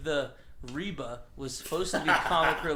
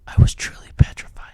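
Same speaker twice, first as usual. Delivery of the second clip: fast breathy hush for a story opening; eerie whispered reading; dramatic whisper; 18 dB louder than usual. Acted. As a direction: whisper it like a spooky tale. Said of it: "begins dramatic fanfic reading".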